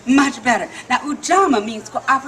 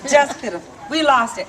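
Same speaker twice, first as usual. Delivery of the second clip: plain reading voice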